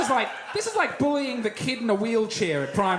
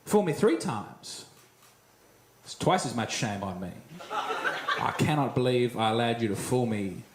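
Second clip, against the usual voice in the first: mock solemn proverb; 10 semitones lower than usual. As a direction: recite it like fake wisdom, voice dropping. Mock proverb routine, mounting self-pity.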